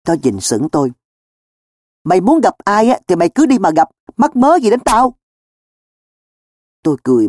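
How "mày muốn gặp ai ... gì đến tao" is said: high-pitched, indignant questioning; raised voice climbing in protest. A narrator's reading. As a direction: push back, as if wrongly accused. Baffled Cường protests to friends.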